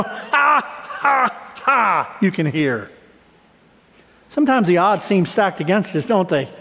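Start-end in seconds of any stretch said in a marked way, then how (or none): 0.1-2.2 higher gloating ha ha ha; mocking enemy laugh. Pastor voicing the gloating enemy, mocking laugh. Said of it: "My enemy will say".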